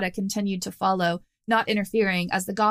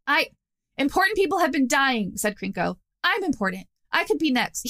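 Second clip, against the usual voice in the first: a pitched-up, lightly voiced nervous crime boss; a hint of a jittery goblin demanding answers; rattled goblin boss; 6 semitones higher than usual. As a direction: Krenko pressing the prisoner, lightly voiced and pitched up. Krenko frightened for his life.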